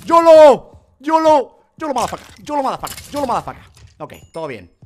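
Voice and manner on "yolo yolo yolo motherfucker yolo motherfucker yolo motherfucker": high, loud battle yell; battle cry. Real, not acted. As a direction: yell it as you charge. Charging into fight.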